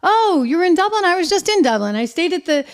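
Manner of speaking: lightly voiced buddy bragging about his trip; Sean Spicer. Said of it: "and Sean's like".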